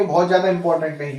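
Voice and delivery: usual speaking voice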